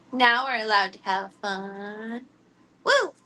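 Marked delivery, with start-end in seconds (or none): 2.8-3.1 high happy whoop; excited woo. Real, not acted. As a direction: cheer it out, up high. Celebrating game time.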